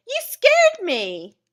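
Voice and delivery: high, loud, drawn-out startled cry; exclaimed fright. Shown as a demonstration. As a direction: exclaim with strong, hammed-up emotion. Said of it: "should be read with some emotion".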